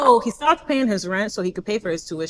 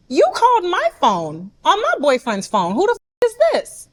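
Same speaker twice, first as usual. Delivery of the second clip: raised pitch, affronted demand; indignant confrontation; 7 semitones higher than usual. Retold or imitated. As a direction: challenge the stranger on the line. Confronts stranger on boyfriend's phone.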